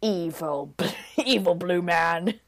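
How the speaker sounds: slow, put-on angry dad voice; hammed-up fatherly growl of outrage; stern father. Juliet's dad forbids the blue man, mock stern voice. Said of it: "Juliet's dad is like".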